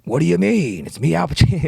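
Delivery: loud, high-pitched movie-star impression; hammed-up, blustering actor impression; bad Al Pacino. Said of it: "It's not even a good impression".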